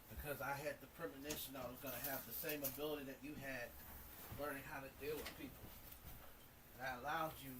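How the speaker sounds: solemn, hushed wise man voice; wise old sage. Acted. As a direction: speak softly like a preaching prophet. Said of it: "I'm tired of talking like a wise man".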